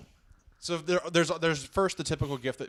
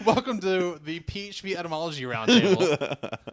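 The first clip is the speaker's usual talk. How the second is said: louder, amused announcer welcome; mock show host; 3 semitones higher, 6 dB louder than usual. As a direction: open a joke roundtable like a host.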